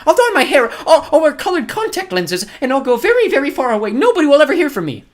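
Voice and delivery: very high, loud frantic begging; desperate. Acted. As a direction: bargain wildly for your life. Woman bargains for her life.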